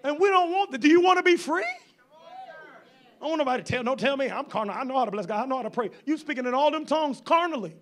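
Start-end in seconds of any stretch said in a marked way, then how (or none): 1.6-5.6 mock defensive churchgoer.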